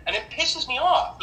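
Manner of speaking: angry outburst. Real, not acted. Husband venting to group about wife, real angry outburst. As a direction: let the anger break through on pisses. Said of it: "I'm getting angry".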